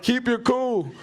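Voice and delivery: high, mock-earnest stranger's voice; lightly voiced nagging stranger; stranger's warning. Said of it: "guy like".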